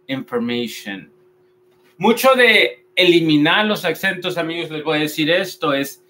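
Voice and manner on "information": "Information" as "in-fer-MEI-shen", low and soft; correct English pronunciation. Shown as a demonstration. Teacher repeats the model for learners, correctly pronounced. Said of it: "vamos a decir information".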